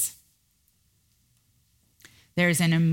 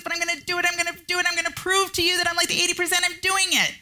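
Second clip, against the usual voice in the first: much higher, rushed, near-tearful panic; frantic pusher; 11 semitones higher, faster than usual. Retold or imitated. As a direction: spiral into exhausted, frantic overdrive.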